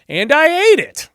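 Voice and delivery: very high, loud and slow; squeaky sheepish confession. Acted. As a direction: squeal out the guilty admission. Adam excuses himself.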